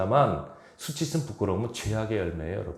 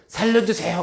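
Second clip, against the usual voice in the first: high, loud, frantic 살려주세요 with a stretched end; urgent, all-out call for rescue; desperate cry for help; 9 semitones higher, 6 dB louder than usual. Shown as a demonstration. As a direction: scream it like you're drowning. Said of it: "아니면".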